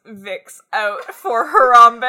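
slow, loud, stuffed-up sick voice; sick congested voice. Retold or imitated. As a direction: say it slowly, like your nose is fully blocked. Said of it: "a very sick voice".

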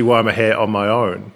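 normal talking voice

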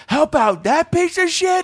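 very high, drawn-out incredulous yell; mock outrage. Toll guy refuses to help the rude passenger, high mock outrage. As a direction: squawk it in disbelief.

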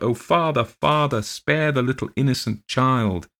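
high, desperate plea; crying out. Acted. Old woman begs her father's ghost to spare the child, high desperate cry. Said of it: "cried out".

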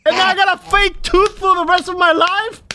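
high indignant disbelief; a hint of a yell, sustained disbelief. Retold outrage continues.

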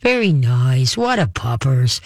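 low, calm dog-soothing voice; calm soothing praise. Shown as a demonstration. Host showing how to settle a dog, calm low praise. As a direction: drop the pitch and soothe the dog. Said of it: "very calmly with your voice".

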